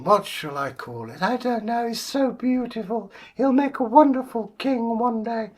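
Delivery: high, sweet queen voice; gushing royal mother, high; queen's high voice. Acted.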